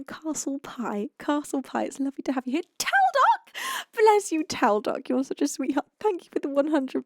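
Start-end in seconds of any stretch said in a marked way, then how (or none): 2.8-4.4 an excited welcome for a friend; excited greeting. Real, not acted. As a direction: call the name out high and loud. Greeting a supporter in chat.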